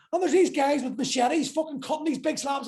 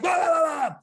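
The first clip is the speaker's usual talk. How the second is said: mock shouting; 4 semitones higher, 5 dB louder than usual. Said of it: "they shout loud".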